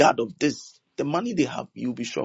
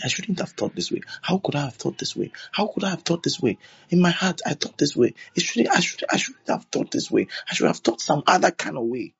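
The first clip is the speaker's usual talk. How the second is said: higher-pitched pleading self-reproach; re-enacted penitent plea, pitched up; remorseful prayer; 7 semitones higher than usual. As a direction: plead with God in a raised pitch, reproaching yourself. Acting his remorseful prayer on his knees.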